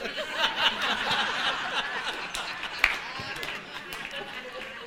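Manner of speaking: a high, soft, airy laugh; high breathless laugh. Real, not acted. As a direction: let out a soundless, giggling laugh. Laughter after the hostess quote.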